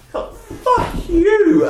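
high, drawn-out indignant curse; comic outrage. Acted. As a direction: stretch it out in disbelief at the sheer cheek. Outraged at Facebook camera advert.

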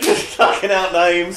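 laughing. Real, not acted. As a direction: crack up while teasing your friends. Laughing at friends' wrong name guesses.

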